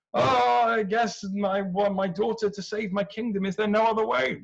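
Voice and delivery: a weak king hoping for a way out; whiny reluctant king. Acted. Cepheus begging Poseidon, whiny and high.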